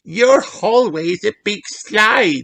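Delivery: put-on high excited child; delighted high voice; little girl voice. A narrator's reading. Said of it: "cries Peppa".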